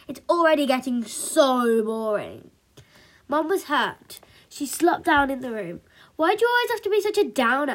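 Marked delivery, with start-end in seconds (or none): 0.1-2.5 put-on loud, bored teenage complaint; fed up and dismissive; exasperated snap. A narrator's reading. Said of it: "snapped Punk". 6.2-7.7 high, loud, fast question; exasperated kid brother; protesting little boy. A narrator's reading. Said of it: "asked Spike".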